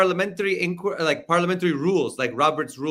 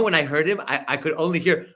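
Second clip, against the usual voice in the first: ordinary talk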